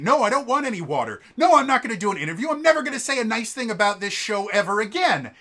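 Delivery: fast, high, loud retold rant; storming off angry. Contestant refusing water and interviews as he storms off, fast loud rant. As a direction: spit it out storming off stage. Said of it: "he storms off".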